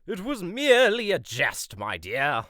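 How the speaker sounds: loud, high exclamation falling on the end; mock-defensive protest. Suitor defending his joke to Ella, exclaiming. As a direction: loudly plead that it was teasing.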